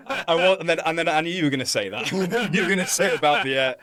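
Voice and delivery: amused, breaking into laughter mid-sentence; laughing. Real, not acted. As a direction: laugh it off, caught out by the joke. Laughing at host's sit-up joke.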